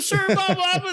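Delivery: squeaky, very high flustered babble; panicked recruit. Retold or imitated. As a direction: stammer like a terrified trainee, pitched sky-high. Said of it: "And guys are like".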